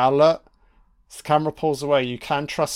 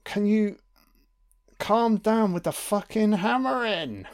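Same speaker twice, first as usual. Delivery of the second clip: exasperated complaint; 7 semitones higher than usual. Retold or imitated. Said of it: "I'd be like".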